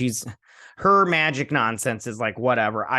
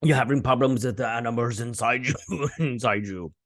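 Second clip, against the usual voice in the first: odd put-on character voice. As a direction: imitate an eerie stranger. Mimicking a strange man in retelling.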